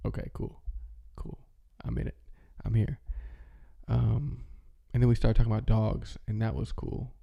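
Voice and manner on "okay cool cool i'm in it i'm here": inner thought. Retold or imitated. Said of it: "I was like".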